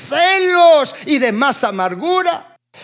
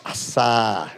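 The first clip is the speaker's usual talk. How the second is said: ordinary delivery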